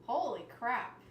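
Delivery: ordinary delivery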